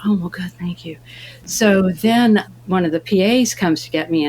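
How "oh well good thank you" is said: whispered reply. Retold or imitated. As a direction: murmur it back almost voicelessly. Modest reply to compliment.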